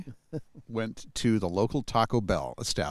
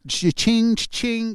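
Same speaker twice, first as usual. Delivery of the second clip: high sung cha-ching; cash register cha-ching; 11 semitones higher, 6 dB louder, slower than usual. Retold or imitated. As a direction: chime it like a till.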